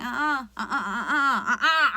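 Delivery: wagging refusal that dissolves into laughter; laughing refusal. Pretend. Refusing as Blake, cracking up.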